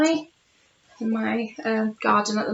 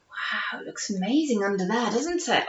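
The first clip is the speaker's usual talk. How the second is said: hushed; 2 semitones lower, 7 dB quieter than usual. Acted. Hushed aside about picture.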